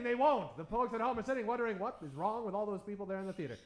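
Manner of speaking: ordinary talk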